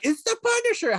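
high, hyped-up reveal; mock news hype; excited announcement. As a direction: announce him like breaking news. Newscasters announcing the Punisher.

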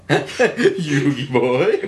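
loud, laughing bad Zuko; mock Zuko impression. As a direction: toss it off like a lazy greaser. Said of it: "what it sounded like he was doing".

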